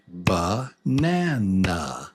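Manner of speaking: Banana drawn out slowly in three separate beats; syllable by syllable. Shown as a demonstration. Teacher counting three beats for learners, Banana slow and split. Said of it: "Yeah, three".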